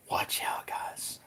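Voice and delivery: whispered, dropping to breath; conspiratorial whisper. Real, not acted. Warning chat about Peter behind his back, whispering. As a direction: lean in and whisper it as a secret.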